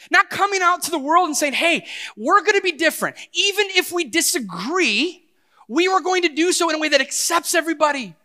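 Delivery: high, loud preaching building in fervour; impassioned preaching. Real, not acted. Preacher voicing a united church to the world, loud and impassioned. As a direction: proclaim it to the whole world at full voice.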